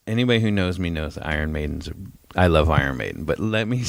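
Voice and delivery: usual speaking voice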